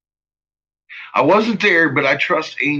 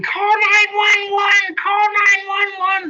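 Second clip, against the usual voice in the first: high, stretched-out mock scream for help; mimicked screaming; slower than usual. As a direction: imitate a frantic scream, dragging each word. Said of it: "Runs out the front door screaming".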